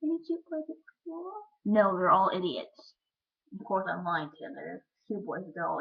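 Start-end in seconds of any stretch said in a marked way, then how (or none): 0.0-1.3 high-pitched mock dad voice; teasing high singsong question. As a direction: imitate a prying dad, pitched way up. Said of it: "him being like".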